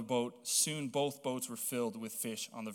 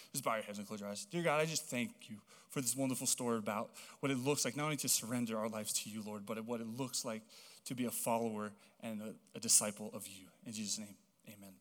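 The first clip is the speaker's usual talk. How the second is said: quiet, reverent prayer voice; soft closing prayer; 3 semitones lower, 6 dB quieter, faster than usual. Acted. Pastor leading the church in prayer, quiet and reverent. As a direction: pray gently over the congregation.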